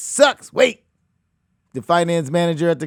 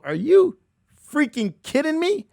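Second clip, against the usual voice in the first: retold disbelief; 10 semitones higher than usual. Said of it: "I go".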